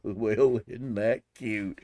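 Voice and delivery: slow cartoon catchphrase after a chuckle; imitated laughing character. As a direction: imitate a cartoon guy, drawing out the words. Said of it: "he would just laugh and go".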